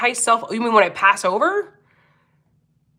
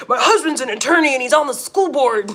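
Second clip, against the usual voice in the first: mock Karen; 4 semitones higher than usual. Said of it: "you're supposed to say".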